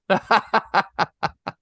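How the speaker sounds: high, bright burst of laughter. Real, not acted. Golfer finishing the story with a high laugh. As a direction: laugh at the memory.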